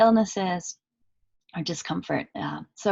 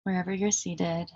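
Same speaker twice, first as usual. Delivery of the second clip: calm meditation guide with long pauses between phrases; unhurried, even voice settling listeners into stillness; guided meditation; 4 dB quieter than usual. Acted. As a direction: lead them gently inward, leaving long silences.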